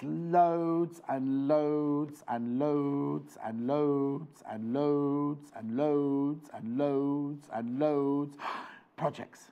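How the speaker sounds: very slow, each "loads" stretched; drawn out. Acted. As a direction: drag out "loads" over and over. Stretching to show many projects.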